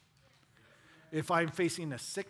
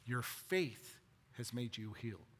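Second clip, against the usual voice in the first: tender Jesus; 4 semitones lower than usual. Retold or imitated. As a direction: comfort her gently. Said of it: "Imagine hearing those words".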